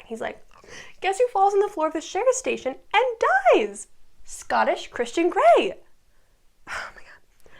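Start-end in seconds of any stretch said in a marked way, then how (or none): 1.0-5.8 higher, louder mock-dramatic announcement; dramatic reveal. Dramatic reveal of Graham's death. 6.7-7.5 breathy shocked whisper; real stunned whisper. Real, not acted. Reacting to sad scene.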